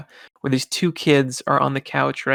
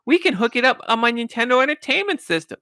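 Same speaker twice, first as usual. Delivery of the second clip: much higher eager nerd impression; 9 semitones higher than usual. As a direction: squeak it out like the excited nerd. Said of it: "And then the nerd goes".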